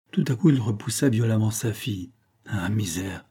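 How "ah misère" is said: a whispered cry of despair; anguished whisper. A narrator's reading. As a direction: breathe out the despair. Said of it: "s'écria-t-il".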